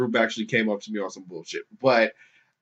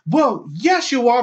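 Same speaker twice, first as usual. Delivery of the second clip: high, louder mock snap; mock retort; 11 semitones higher, 4 dB louder than usual. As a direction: fire back smugly. Protester's retort retold.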